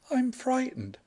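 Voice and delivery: high, timid woman's voice; a heroine's small frightened admission. Acted. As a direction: say it like a scared young lady.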